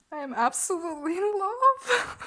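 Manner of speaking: high, fast gush into laughter; overcome with delight. Real, not acted. Vlogger cuddling her plush, a delighted mess. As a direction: squeal with adoration, breaking into a laugh. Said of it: "I'm a mess right now".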